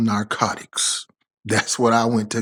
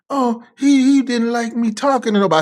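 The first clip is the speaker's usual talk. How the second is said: much higher, mock girlfriend answering; girlfriend's voice; 10 semitones higher than usual. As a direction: put on her high, excuse-making voice. Girlfriend voiced in retold quarrel.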